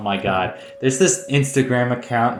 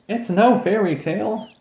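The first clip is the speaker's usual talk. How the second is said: character voice; 11 semitones higher than usual. Acted. Game dialogue read aloud.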